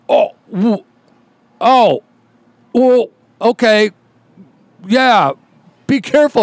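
high, slow backing-down voice; mock tough guy losing steam; a hint of a biker caving in; imitated deflated biker.

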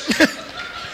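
short, soft chuckle; breathy little laugh after the joke. Real, not acted. Laughs at own story.

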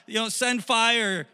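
high, loud worshipper's cry; mock fervent shouting; acted shouted plea. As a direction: call up to your god for a sign. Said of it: "they're shouting".

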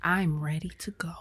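whispered; hushed urgent text. Retold or imitated. Texting a friend about being ready to leave, hushed. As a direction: mutter it urgently. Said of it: "like, "Look".

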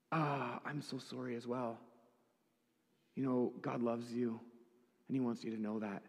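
soft, tender reassurance; retold gentle comforting. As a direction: console her quietly, as when retelling a sad moment. Comforts crying woman, retold.

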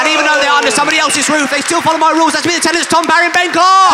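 high, loud live-show announcement; show announcer. Acted. Host introduces the tenants at the comedy fest, shouted announcement. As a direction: shout the intro over the crowd, pitched high.

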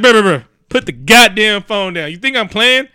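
high, loud mock threat; acted-out armed standoff bluster; heated warning. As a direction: order him to back off, exasperated.

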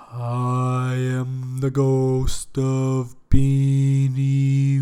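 loud, slow, drawn-out ghostly voice; a spirit intoning from the can, slow and loud; booming ghost. Acted. Ghost speaking from the beans.

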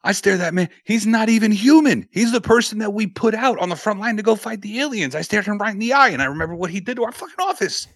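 high, loud, frantic rant; hammed-up high-pitched freakout; Pat McAfee impression. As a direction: shriek it like a panicked fan. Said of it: "and he goes".

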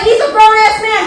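ordinary talk, nothing marked

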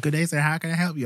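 lightly put-on American call centre voice; a hint of a bright Texan greeting; mock American accent. Mimicking the call centre woman who picked up, light mock American accent. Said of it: "She's like".

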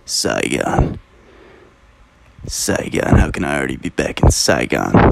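low, hushed movie voice-over; Apocalypse Now quote. Retold or imitated. Vlogger quoting the opening line, low and hushed. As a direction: mutter it low and weary like a soldier's voice-over. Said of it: "he says".

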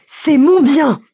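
loud, high, possessive retort; shouting. A narrator's reading. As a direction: shout it high, asserting ownership. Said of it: "crie Mikolka". (cri haut et fort de propriétaire)

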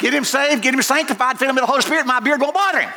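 high, put-on voice bargaining with God; high self-righteous mock. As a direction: plead your own case in falsetto.

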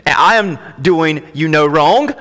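"I am doing you no wrong" slow and loud, rising on "wrong"; deliberate, weighty stress with a questioning lift on "wrong"; emphatic reading. Shown as a demonstration. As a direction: lean slowly on "I am doing you", voice lifting at "wrong". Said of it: "That's the emphasis".

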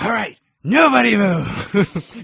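gruff slow stick-up threat; hostage taker voice. Retold or imitated. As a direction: growl it like a toy bandit. Quoting Potato Head stick-up.

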